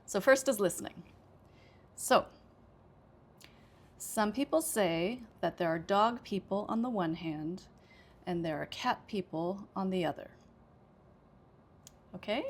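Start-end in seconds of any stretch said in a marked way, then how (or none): 4.0-10.3 reading with pauses between thought groups; demo thought groups. Shown as a demonstration. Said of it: "And I'll read it first".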